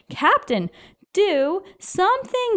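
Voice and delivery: high, drawn-out plea to act now; urgent shout. A narrator's reading. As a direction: call the captain into action. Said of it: "shouted Bartholomew".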